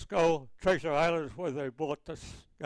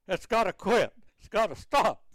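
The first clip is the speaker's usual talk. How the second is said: higher, slightly louder mock yell; retold yelling; 5 semitones higher, 4 dB louder than usual. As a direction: re-enact the captain's yelled order, mildly. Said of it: "Captain come running down the stairs yelling".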